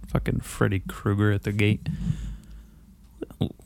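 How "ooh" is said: ordinary talk, nothing marked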